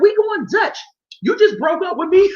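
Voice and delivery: high, loud indignant yell; mock outrage, voice up high and loud; mock outraged yell. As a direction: yell it like you can't believe the nerve. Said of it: "I'd have been like".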